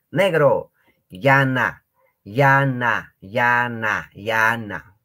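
llana called loud, fading on each repeat; teacher pronunciation drill; loud model word. Shown as a demonstration. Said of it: "teacher repeating the word".

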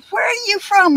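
high-pitched imitation of people asking; curious questioner. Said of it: "they used to say".

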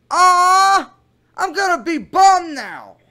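mock disappointment as the teen girl Kayla; hammed-up girlish pout. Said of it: "my Kayla voice".

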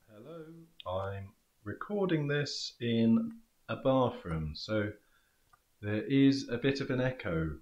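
recorded in an echoey bathroom; distant, hollow room echo. Real, not acted. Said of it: "So you notice that towards the end there".

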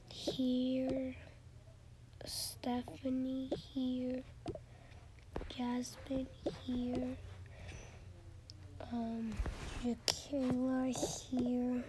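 slow soft whispered replies; whispering. Pretend. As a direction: breathe each answer quietly. Students answer roll call.

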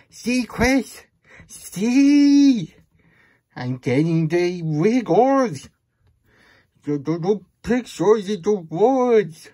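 high, loud, wiggly silly voice. Acted. Reader acting out the silly book lines to Chris, high and loud. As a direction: ham it up like the words are a joke.

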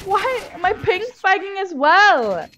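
high, loud frustrated outburst; exasperated yell. Real, not acted. Gamer protesting a ping spike mid-match, exasperated yell.

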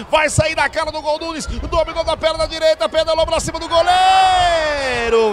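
rising fast play-by-play; building excitement. Real, not acted. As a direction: build the tension as he nears goal. Chance de gol ao vivo. (narração empolgada crescendo)

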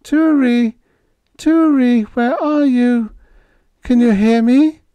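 put-on high, loud, trembling call of a girl; quavering call. A narrator's reading.

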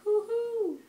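high loud owl hoo-hoo; owl hoot. Shown as a demonstration. Parent reviewing the book hoots a high owl sound.

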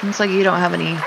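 ordinary delivery

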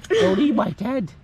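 high, loud, drawn-out protest; scolding the pet. Real, not acted. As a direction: call out to stop the chewing. Scolding goat chewing the tent.

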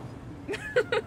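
high short laugh; guilty giggle. Real, not acted. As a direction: giggle awkwardly. Laughs after turning traveller away.

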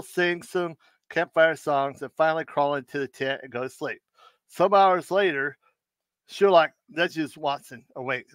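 ordinary delivery

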